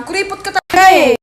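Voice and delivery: loud, accusing jab; wife scolding her stingy babe; loud complaint. Acted. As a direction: call him cheap loudly.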